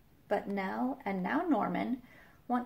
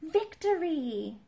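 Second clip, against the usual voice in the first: slow, high, louder victory cry; triumphant shout; 8 semitones higher, 4 dB louder, slower than usual. Acted. Norman finding the doll leg, triumphant shout. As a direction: cheer it out. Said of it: "he shouts".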